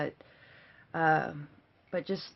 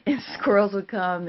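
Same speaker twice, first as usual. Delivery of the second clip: louder, words carried on a laugh; laughing through words; 4 semitones higher, 8 dB louder than usual. Real, not acted. Mom telling the kids about squirrels, laughing. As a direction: talk while still laughing.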